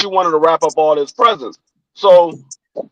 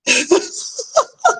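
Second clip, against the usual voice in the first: high, soft laughter; 5 dB quieter than usual. Real, not acted. Laughing after refusing the outfit, high soft laugh.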